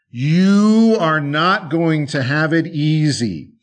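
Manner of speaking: slow, swelling menace; livid wolf threat. Acted. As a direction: lean hard on the opening word, threatening. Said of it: "the wolf was absolutely livid".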